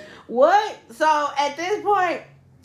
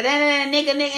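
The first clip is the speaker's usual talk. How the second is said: ordinary talk, nothing marked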